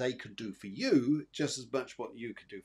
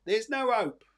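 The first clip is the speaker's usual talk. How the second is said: higher, louder voicing of hopelessness; mock despair; 9 semitones higher, 8 dB louder than usual. Medium voicing the sitter's despair, mock hopeless. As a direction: lightly act out giving up hope. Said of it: "you'll think sometimes".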